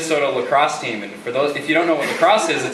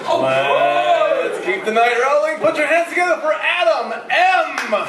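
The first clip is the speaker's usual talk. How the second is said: much higher, slow announcer voice; announcer intro; 12 semitones higher, slower than usual. Real, not acted. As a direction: draw out the intro like an MC. MC introducing the next act.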